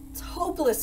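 high-pitched wail of defeat; despairing cry. A narrator's reading. Baker with only one piece of dough left, despairing. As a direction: cry out in despair, without much volume. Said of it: "he cried".

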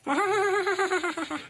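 stuttering, wailing fake sob; boo-hoo in a long rattling run; put-on wail. Scolded boy starts bawling at his mother, put-on wail. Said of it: "ಆಲೆ ಅಳೋಕ್ start ಮಾಡ್ಬಿಡ್ತಾನೆ".

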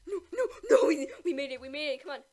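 gasping, laughing with relief; relieved laughter. Real, not acted. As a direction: laugh it out, barely survived. Scraping through the round.

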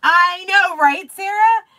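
loud, high exclamation; high, loud, excited agreement; emphatic agreement. Real, not acted. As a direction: agree eagerly with a friend, voice soaring. Agrees eagerly with a viewer.

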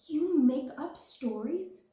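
slow, loud and high astonished cry; gasped. A narrator's reading. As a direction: gasp out the question in amazement. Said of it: "she gasped".

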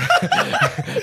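sudden very high laugh; high laugh burst. Real, not acted. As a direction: crack up mid-sentence.

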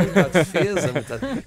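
laughing. Real, not acted. Laughing repeat of host's joke.

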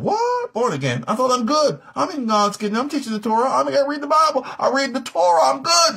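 high-pitched, hammed-up disbelief; incredulous protest. Torah teacher told he must be born again, incredulous protest. Preacher acts out Nicodemus protesting.

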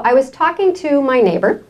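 ordinary talk, nothing marked

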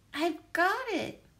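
Aha, got it – a bright, pleased little cry; excited exclaim. Acted. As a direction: snap it out like solving a riddle. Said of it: "I said".

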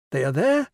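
"They are there" swung up to a high rising end; appearing. A narrator's reading. Teacher making figures appear, rising "there". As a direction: lift "there" like a conjured surprise. Teacher toggling the pretend vision.

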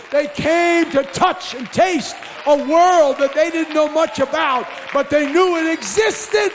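shouted sermon, loud and high, hammering each line; full-force preaching. Acted. Preacher shouting to his congregation about why people came. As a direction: preach it hard to the back row.

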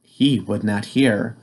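usual speaking voice

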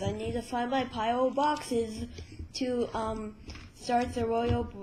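ordinary talk

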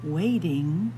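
ordinary delivery, nothing marked

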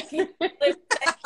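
delighted mock insult; laughing mock curse. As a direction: swear affectionately while laughing. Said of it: "lo primero que pensé".

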